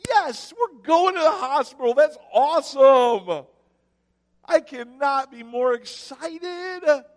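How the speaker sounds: high, loud, over-the-top fake delight; sarcastic mock excitement. Pastor mocking his joy on the way to the hospital, hammed-up glee. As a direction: gush like the hospital is a treat.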